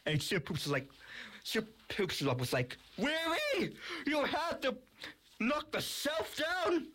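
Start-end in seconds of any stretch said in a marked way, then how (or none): 3.0-6.9 high-pitched exasperated complaint; acted scolding. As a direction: scold a clumsy friend in a high voice. Toy scolds another over shelf.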